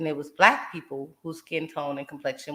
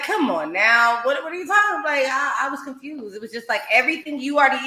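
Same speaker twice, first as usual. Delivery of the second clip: ordinary delivery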